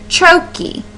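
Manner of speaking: "Trochee" with first syllable high, second light; stressed first syllable. Shown as a demonstration. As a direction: punch the start of "Trochee", let the end fall light. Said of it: "that's how the emphasis would be too".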